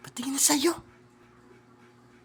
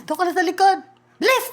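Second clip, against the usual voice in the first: higher, louder command; raised bossy order; 5 semitones higher, 6 dB louder, faster than usual. Real, not acted. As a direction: send her back, loud. Ordering child back.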